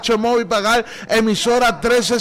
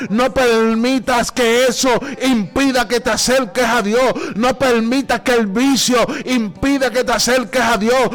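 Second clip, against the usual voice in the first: relentless, full-voiced exhortation; fervent preaching; 4 semitones higher than usual. Real, not acted. Preacher exhorting sick listeners to call on God, fervent preaching. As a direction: urge the sick to cry out, driving on without letup.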